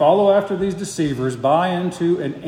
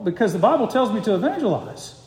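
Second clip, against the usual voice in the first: ordinary delivery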